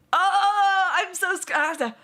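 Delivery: hammed-up "oh" starting high, then a slow mock-scared line; mock fear. Streamer fakes fear over a Twitch ban, hammed-up. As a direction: pretend to be frightened, teasing.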